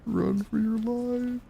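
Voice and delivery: high, hammed-up shriek to flee; panicked yell. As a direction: cry out in mock terror, high. Mock panic fleeing enemies.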